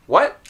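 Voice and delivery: "What" cut off on a held t, no puff; rising "What" with no audible t release; natural unreleased T. Shown as a demonstration. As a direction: keep the tongue up at the end of "What". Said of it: "without the T being released".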